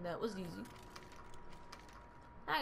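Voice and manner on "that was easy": low, soft catchphrase imitation; understated mimicked slogan; Staples button. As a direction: drop it low and quiet like a recorded button. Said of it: "I need that Staples button".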